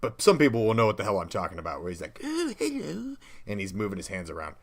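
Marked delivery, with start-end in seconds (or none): 2.2-3.2 very high, goofy comic voice; squeaky silly hello from a comedy film; Adam Sandler weird voice. Retold or imitated. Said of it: "whenever Adam Sandler talks really weird".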